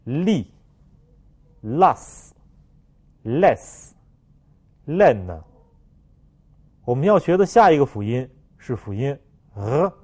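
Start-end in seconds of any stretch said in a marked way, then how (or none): 0.0-5.4 "力，las，less，len" very slowly, word by word; "las，less，len" as isolated French drill words; read-after-me words. Shown as a demonstration. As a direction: model each l word with long pauses. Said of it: "请大家跟我读".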